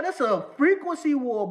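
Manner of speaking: usual speaking voice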